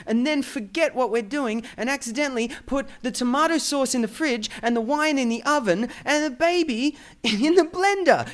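mock suggestion climbing in pitch; sarcastic rant. Host ridiculing Dutch fridge habits, sarcastic.

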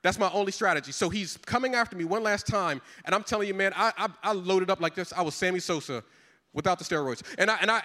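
normal talking voice

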